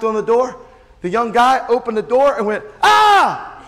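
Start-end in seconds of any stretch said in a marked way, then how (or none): 2.8-3.6 high, loud startled scream; imitated yell. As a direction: scream like someone caught at the door. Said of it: "And literally yelled and ran".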